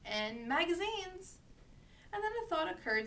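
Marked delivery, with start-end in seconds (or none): 0.5-1.4 high-pitched vendor's cry; newsboy's call. A narrator's reading. Boy selling at the newsstand, mild calling out. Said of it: "he forgot to shout".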